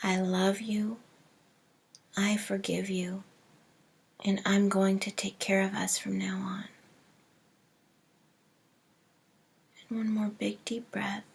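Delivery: soft, hushed meditation voice with long pauses; hushed, unhurried visualization, fading to near whisper; guided meditation. Acted. Leads a breathing meditation.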